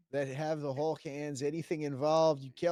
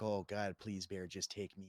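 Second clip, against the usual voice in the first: hushed plea; 8 semitones lower, 8 dB quieter than usual. Retold or imitated. Hiding from bear, begging.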